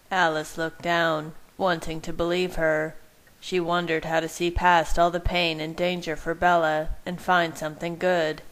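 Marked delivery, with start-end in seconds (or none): none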